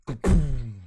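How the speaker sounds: very loud imitated blast; explosion noise. Reader imitates Atlas blowing herself up, explosive. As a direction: boom it out like a blast. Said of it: "And Atlas goes".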